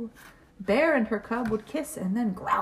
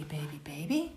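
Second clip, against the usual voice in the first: whisper; 8 semitones lower, 5 dB quieter than usual. Acted. Refrain whispered in story.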